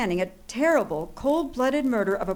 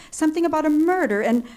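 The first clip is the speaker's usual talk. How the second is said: fast, louder and higher in alarm; panicked rush; 5 semitones higher, 6 dB louder, faster than usual. Acted. Frantic woman telling the operator about a murder, panicked rush. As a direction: blurt it out in a panic.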